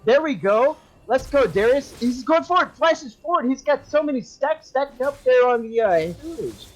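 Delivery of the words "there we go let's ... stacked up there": excited play-by-play. Real, not acted. Big Darius play erupts.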